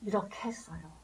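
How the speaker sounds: breathy hushed whisper. Acted. Preacher whispering the blind man's testimony to the congregation. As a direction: drop to a secret whisper.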